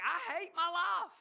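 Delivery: hammed-up lament from Job; Job's lament. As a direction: voice Job moaning that he hates his life. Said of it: "Then Job, like".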